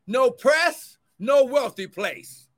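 loud, rising sermon cadence; fervent preacher building; preaching at full force. Acted. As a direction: drive the point home to the church. Preaching rebuke.

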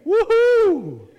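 high, loud joyful woohoo; cheering whoop. Retold or imitated. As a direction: whoop like a fan at a game. Imitates cheering lady in picture.

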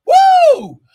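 sudden high whoop of joy; ecstatic high woo. Real, not acted. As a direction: let out a joyful shriek of praise. Preacher's ecstatic outburst.

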